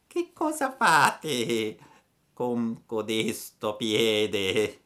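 lenta e acuta; lumaca. A narrator's reading. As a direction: domanda con calma sorniona. La lumaca chiede. (la lumaca chiede a Pinocchio, lenta e acuta)

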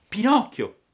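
acuto e forte; si annuncia gridando; burattino. A narrator's reading. Pinocchio risponde. (Pinocchio dice il suo nome, acuto e forte)

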